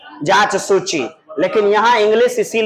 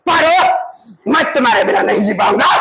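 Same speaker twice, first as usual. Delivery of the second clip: dramatic declaration. Shown as a demonstration. Teacher replays lover's plea to Paro without weeping, dramatic declaration. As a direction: call out the name long, then rush the vow.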